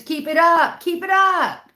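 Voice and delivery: high, loud cartoonish voice; dog's meaning voiced. Voicing the dog's cheer, high and loud. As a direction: squeal it like an excited dog. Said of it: "it means".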